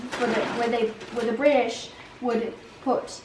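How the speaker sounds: easygoing Australian-accented English. Shown as a demonstration. Child explains the prison island history, Australian accent. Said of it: "Uh, Australian".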